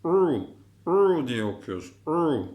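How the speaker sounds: "u" squeezed out from the throat. Shown as a demonstration. Teacher models the Arabic letter ayn, squeezed throaty "u". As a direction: tighten the throat for "u". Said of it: "Boğaz harflerinden".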